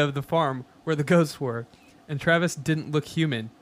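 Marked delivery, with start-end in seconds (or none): none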